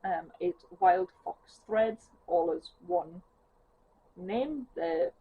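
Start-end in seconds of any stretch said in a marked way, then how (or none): none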